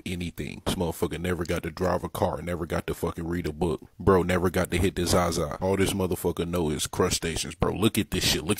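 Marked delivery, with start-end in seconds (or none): none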